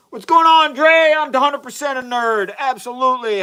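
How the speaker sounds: excited greeting. Real, not acted. Excited greeting to chat viewer.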